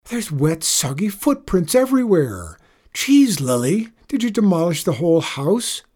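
high, loud accusing outcry; shriek. Acted. Sister pointing out footprints on carpet, shrieking. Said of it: "Then she shrieked".

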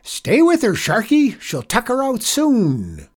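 high, loud cowboy cheering; cried out. A narrator's reading. Janitor urging the boy to hang on, cried out. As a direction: holler the rider on. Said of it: "cried Mister Leeks".

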